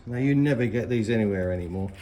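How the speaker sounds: ordinary delivery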